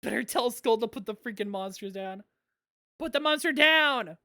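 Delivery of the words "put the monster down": louder, higher called-out command; mock urgent order. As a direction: call it out like an order. Calling out an order in game.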